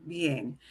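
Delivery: bien as one short, low glide; bien slid together. Shown as a demonstration. Teacher modelling for students, bien in one quick glide. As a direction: blend i and e in bien smoothly. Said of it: "we slide them together".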